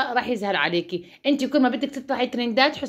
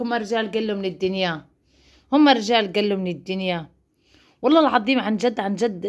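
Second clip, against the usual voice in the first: usual speaking voice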